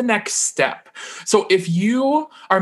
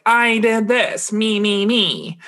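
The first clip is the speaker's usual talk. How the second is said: mocking self-obsessed; 6 semitones higher, slower than usual. Mocking self-centered posts.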